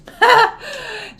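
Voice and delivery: bursts into a high laugh; real laugh at her own joke; short high giggle. Real, not acted.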